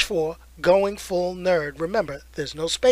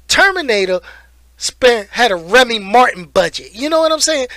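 emphatic; 4 semitones higher, 7 dB louder than usual. Real, not acted. Emphatic joke about budget.

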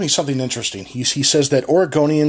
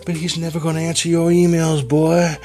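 smooth late-night radio voice; 3 semitones lower, 7 dB quieter than usual. Shown as a demonstration. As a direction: croon it like a midnight DJ seducing the mic. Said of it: "You need to".

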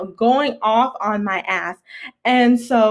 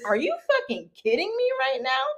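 very high incredulous question; appalled; 14 semitones higher, 4 dB quieter, faster than usual. Retold or imitated. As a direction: re-enact outraged disbelief, voice pitched way up. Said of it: "and I was appalled".

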